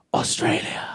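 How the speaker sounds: hushed, voiceless whisper; awed whisper. Retold or imitated. Telling Scottish people where his wife is from, awed whisper. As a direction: whisper it like an exotic secret. Awed reveal of exotic country.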